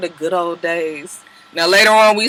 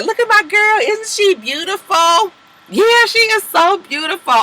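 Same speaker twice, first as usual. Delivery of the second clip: mock gushing; 10 semitones higher than usual. Sierra showing off her friend.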